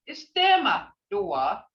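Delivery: ordinary talk, nothing marked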